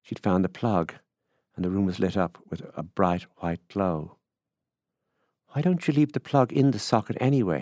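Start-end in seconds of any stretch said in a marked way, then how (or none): 5.5-7.6 higher-pitched critical question. A narrator's reading. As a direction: question it with mild disapproval. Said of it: "he asked critically".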